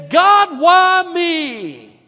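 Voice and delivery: high, loud wail to heaven; anguished cry. Retold or imitated. Stranded man wailing up at God. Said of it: "he looks up into the mountains and he says".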